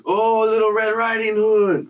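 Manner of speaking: high falsetto catcall, teasing; mocking catcall. Adult inmate teasing the new kid in red hat: high falsetto catcall. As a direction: sing it out high like mocking a girl.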